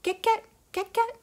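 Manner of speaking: very high croaking get get; tree frog croak. A narrator's reading. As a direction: chirp it high like little tree frogs. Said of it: "the tree frogs began".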